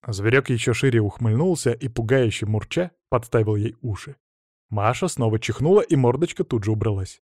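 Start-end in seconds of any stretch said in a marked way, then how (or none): none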